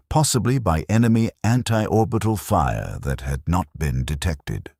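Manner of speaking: ordinary delivery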